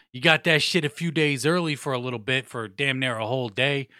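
normal talking voice

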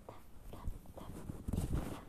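soft whispered tapping of rain; imitated raindrops. Rain returns to the jungle, soft whispered tapping. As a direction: whisper the taps like falling drops.

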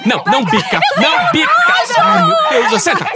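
high, loud, frantic cries; panicked yelling. Acted. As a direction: chase after the runaway hen. Hen escapes, magician panics.

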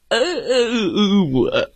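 mock sobbing whimpers; whimpering bear. Bear stuck in three places, hammed-up whimper.

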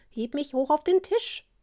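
high-pitched frog voice; demanding frog. A narrator's reading. Frog asks the princess for a lift, demanding. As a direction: pipe up to the princess from below. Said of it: "glotzte der Frosch zur Prinzessin hinauf".

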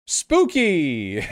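plain excited exclamation; excited spooky. Real, not acted. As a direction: call it out with delight. Reacting to the trees.

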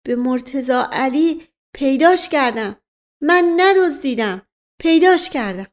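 frightened servant insisting he is innocent; broken, frightened words. Acted. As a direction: plead in halting bursts like a trembling servant.

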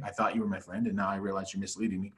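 ordinary talk, nothing marked

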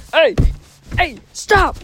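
shouting. Acted. Yelling at fighting characters.

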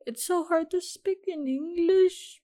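high-pitched mock-despairing complaint; exasperated whine. Real, not acted. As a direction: wail it after tripping over your words. Frustrated outburst after fluffing a word.

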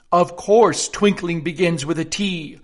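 sharp. A narrator's reading. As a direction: snap it back at him. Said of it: "said the king sharply".

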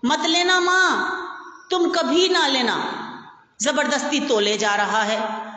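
put-on high, insistent child voices; children protesting, pitched well up; upset children. A narrator's reading. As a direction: kids begging their mother not to buy. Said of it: "सभी बोल उठे".

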